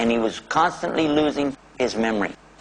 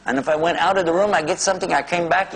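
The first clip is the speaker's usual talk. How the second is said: usual speaking voice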